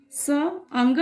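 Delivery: says the sandhi parts very slowly and clearly; slow demo. Shown as a demonstration. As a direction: spells it out syllable by syllable for the class. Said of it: "इसका उच्चारण". (धीमा, साफ़ उच्चारण)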